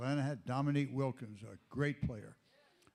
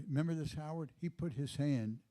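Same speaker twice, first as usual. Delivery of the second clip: normal talking voice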